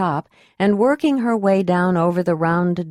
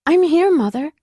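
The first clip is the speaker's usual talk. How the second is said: put-on lightly raised young woman's voice; young acolyte; 10 semitones higher, 3 dB louder than usual. A narrator's reading. Acolyte answers her mother.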